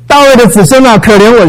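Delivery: high, loud cry for mercy; blind man shouting. Retold or imitated. Blind man calling to passing Jesus, high loud desperate cry. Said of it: "瞎子在那边喊".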